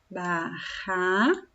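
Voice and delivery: barraca drawn out slowly, strong RR; slow syllables. Shown as a demonstration. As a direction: stretch barraca for the class. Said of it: "Se eu escrevesse ba".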